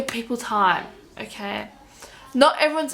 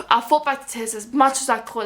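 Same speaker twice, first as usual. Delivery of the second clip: normal talking voice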